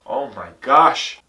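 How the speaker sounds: a drawn-out, alarmed oh my gosh; startled. Acted. Dad realizing they are late, startled. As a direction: catch the late hour with a jolt.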